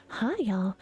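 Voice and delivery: lightly hushed Southern belle greeting; close-mic Southern belle; Southern Belle ASMR. Pretend. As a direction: attempt a Southern drawl in ASMR style. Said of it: "I can't do Southern Belle A S M R".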